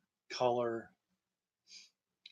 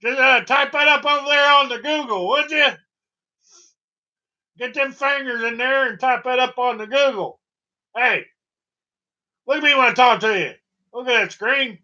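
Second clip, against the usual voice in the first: put-on high, loud grumpy old-timer; cranky old man; 12 semitones higher, 14 dB louder than usual. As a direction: boss the viewer like a crotchety grandpa. Cranky old man bossing viewer.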